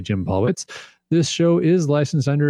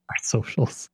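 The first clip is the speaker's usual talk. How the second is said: whispered, mock-hushed repeat of the trendy word; whispered echo; 7 dB quieter than usual. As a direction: breathe it like a secret youth slang. Mocking echo of trendy phrase.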